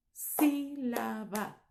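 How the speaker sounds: "Sílaba" very slow as SÍ, la, ba, leaning on SÍ; syllables, first one strongest. Shown as a demonstration. Teacher shows the stressed syllable, "Sílaba" drawn out as SÍ-la-ba. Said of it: "Tenemos el sonido más fuerte en la primera sílaba".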